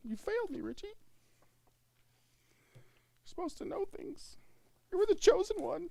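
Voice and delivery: high, theatrical betrayed hero voice; overacted movie accusation; mock dramatic accusation.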